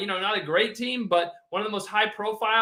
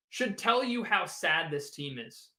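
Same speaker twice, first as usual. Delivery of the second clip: ordinary talk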